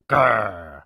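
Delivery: troll growl. A narrator's reading. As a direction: growl as you close in. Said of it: "said the troll".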